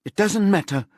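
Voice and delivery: high, loud, voice breaking into tears. A narrator's reading. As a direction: let the voice crack into crying. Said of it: "Vicky's voice breaks and she starts to cry".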